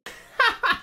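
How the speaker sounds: sudden high burst of real laughter. Real, not acted. Streamer cracking up at the prank call, laughing hard.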